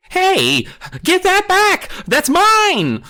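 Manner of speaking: high, loud demand; demanding shout. A narrator's reading. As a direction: yell after the stolen Frisbee. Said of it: "Kermit demanded".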